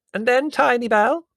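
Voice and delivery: put-on very high, loud old aunt; loud interruption. A narrator's reading. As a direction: butt in loudly, half deaf. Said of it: "interrupted Aunt Fan".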